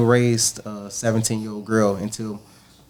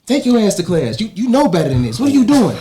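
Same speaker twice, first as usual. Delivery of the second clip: higher, louder mock teacher scolding; 10 semitones higher, 4 dB louder than usual. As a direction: scold a student the way a teacher would. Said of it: "the time I think I got mad".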